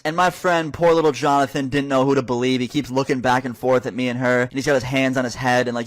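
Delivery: ordinary talk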